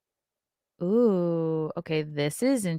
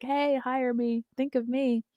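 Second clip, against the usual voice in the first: a lightly voiced celebrity begging, higher and slow; mock needy celebrity; 7 semitones higher, 4 dB quieter than usual. A fading celebrity mock-pleading to Hollywood for work. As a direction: plead softly to be remembered, a touch hammed-up.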